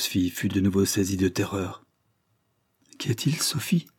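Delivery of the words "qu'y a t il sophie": frightened whisper, stammering; frightened lady whispering to her maid; stammered. A narrator's reading. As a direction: ask in a scared breathless whisper. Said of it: "bégaya-t-elle".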